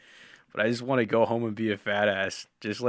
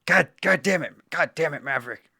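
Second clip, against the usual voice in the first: higher, louder movie-officer yell; Top Gun quote; 6 semitones higher, 5 dB louder than usual. Retold or imitated. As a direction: bark it like a furious commanding officer. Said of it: "I could do some Top Gun shit".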